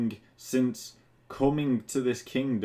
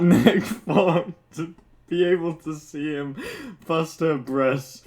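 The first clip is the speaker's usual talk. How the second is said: normal talking voice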